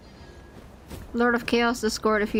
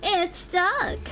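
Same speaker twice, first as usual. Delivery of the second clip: higher, sillier retake of "It's stuck!"; Venti impression; 9 semitones higher than usual. Streamer redoes Venti line after chat's critique, goofier. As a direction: push the goofy bard whine on "stuck". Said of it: "That's exactly how he says it".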